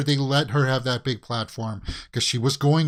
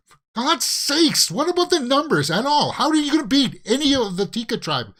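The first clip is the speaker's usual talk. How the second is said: much higher and louder, ranting at a contestant; exasperated rant; 10 semitones higher, 3 dB louder than usual. Real, not acted. Fan yelling at Heidi over her vote, exasperated and much higher. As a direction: sputter in disbelief at a bad vote. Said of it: "I'm not gonna get my feathers ruffled here".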